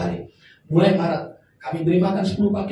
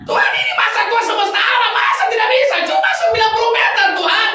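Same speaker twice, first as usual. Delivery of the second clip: desperate loud prayer; 12 semitones higher, 4 dB louder than usual. Retold or imitated. Retold pleading prayer at the house.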